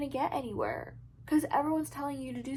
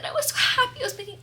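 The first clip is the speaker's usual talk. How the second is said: excited; 11 semitones higher, faster than usual. Real, not acted. Gushing about her research breakthrough.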